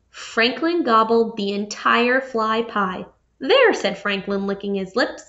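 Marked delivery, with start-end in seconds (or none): 3.4-3.7 triumphant there. Acted. Franklin shows off after eating.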